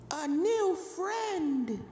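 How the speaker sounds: put-on high, soft, slow ghostly child voice; breathy-free whispery high ghost colt, drawn out; eerie child ghost. A narrator's reading. Ghost colt greeting a new playmate, high and eerie. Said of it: "said the red colt excitedly".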